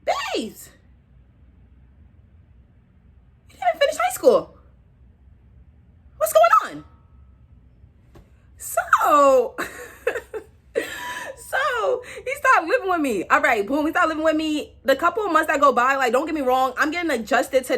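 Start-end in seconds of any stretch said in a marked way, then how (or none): 0.0-6.8 high-pitched mock scolding. Friend scolds the girl for dropping out, high mock scold. Voicing someone scolding her. 8.6-13.2 laughing. Real, not acted. Laughing at her own story.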